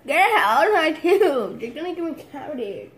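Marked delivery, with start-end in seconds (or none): 0.1-1.6 loud playful protest. Real, not acted.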